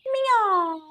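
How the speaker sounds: high imitated cat meow. Cat lures crow indoors, high meow.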